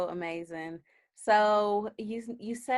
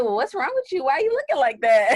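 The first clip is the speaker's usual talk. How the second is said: higher, louder prying questions; nosy concerned folks; 7 semitones higher, 10 dB louder than usual. Retold or imitated. Interviewer mimicking people asking what's wrong, prying. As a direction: mimic people quizzing a sad friend. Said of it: "Everybody like".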